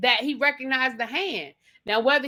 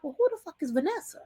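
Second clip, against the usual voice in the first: high, incredulous question; indignant surprise; 6 semitones higher, 5 dB quieter than usual. Retold or imitated. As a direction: squeal the name in disbelief. Viewer's own indignant retold reaction.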